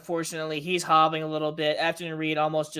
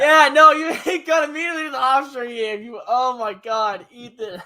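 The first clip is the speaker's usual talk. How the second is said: laughing; 5 semitones higher, 5 dB louder than usual. Real, not acted. Laughing at abrupt topic change.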